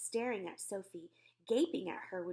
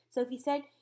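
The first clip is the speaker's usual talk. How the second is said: plain reading voice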